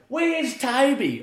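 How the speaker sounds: put-on high, drawn-out call for a child; slow, high-pitched mock call; mum calling out. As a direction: imitate a mum hollering for her kid. Mum calling for her missing kid.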